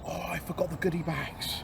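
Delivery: hushed dismayed whisper; whispered oops. Acted. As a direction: breathe it out like a guilty secret. Mock dismay over forgotten bags.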